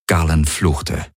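ordinary delivery, nothing marked

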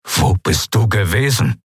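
understated angry demand from a father; barely contained turmoil. A narrator's reading. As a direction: hold the anger in while demanding an answer. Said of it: "seinen inneren Aufruhr zu bändigen". (mühsam gebändigter Ärger)

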